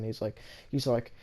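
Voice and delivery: ordinary delivery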